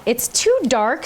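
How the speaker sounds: ordinary talk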